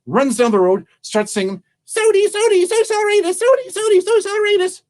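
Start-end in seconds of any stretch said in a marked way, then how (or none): 1.8-4.8 very high, rapid squirrel chant; squeaky high-speed singsong refrain; fast squirrel. Acted. Squirrel sings refrain while running.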